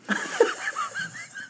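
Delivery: a high, embarrassed laugh; high burst of laughter. Real, not acted. Musician laughs after admitting he barely studies. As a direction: laugh it off, caught out.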